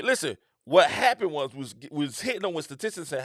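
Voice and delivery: normal talking voice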